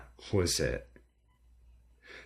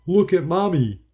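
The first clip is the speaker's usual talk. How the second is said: high, loud, bright clown call; best clown voice; 12 semitones higher, 10 dB louder than usual. A narrator's reading. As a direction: play the silly clown to win the child. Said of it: "I said in my best clown voice".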